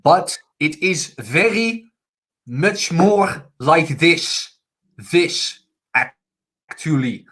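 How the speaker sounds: very slow, heavy Dutch-accented English. Shown as a demonstration. Co-host demonstrates how Dutch people sound speaking English, slow Dutch accent. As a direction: drag the words out in a thick Dutch accent. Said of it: "I can do the Dutch English accent".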